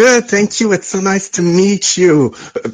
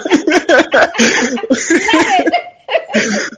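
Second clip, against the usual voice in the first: high giggle; 13 semitones higher than usual. Real, not acted. Caller laughs after French kisses.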